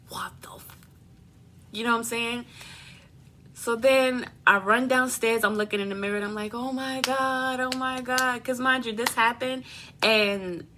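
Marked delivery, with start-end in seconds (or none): 0.1-0.8 hushed, voiceless shock, cut short; stunned whisper. Retold or imitated. Woman reacting to her swollen face, stunned whisper. 6.5-8.4 higher, drawn-out retold panic; mock panic. Retelling the look in the mirror downstairs, mock panicked wail.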